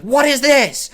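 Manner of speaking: high, loud mock shout of an angry mom; mom's loud shocked yell at the phone screen. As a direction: shout it up high like a furious mom catching you. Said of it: "She's like".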